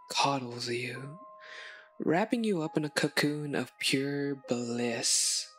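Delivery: ordinary delivery, nothing marked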